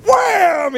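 sudden high shouted wham like a faith healer's strike; healing wham. Retold or imitated. Preacher mimicking a faith healer's strike, high shouted wham. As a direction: slam it out like a showy healer. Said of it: "go like".

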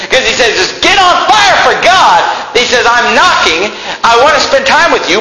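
hollering the call from the pulpit; full-force fiery preaching. Acted. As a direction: whip the congregation into getting on fire for God.

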